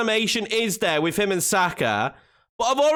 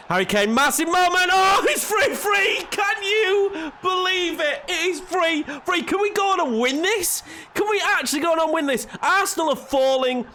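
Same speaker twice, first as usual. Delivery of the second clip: game commentary bursting into excited high cries; excited yelling; 8 semitones higher than usual. Real, not acted. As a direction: cheer the team on as the chance opens up. Late equaliser chance, excited yelling.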